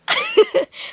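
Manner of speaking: very high, loud giggle burst; real laugh. Real, not acted. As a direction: crack up at the memory. Laughs at his request.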